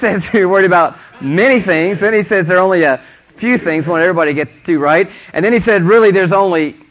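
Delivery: emphatic preaching. Real, not acted. Preacher has congregation count the things with him, emphatic. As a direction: drive each count home loudly.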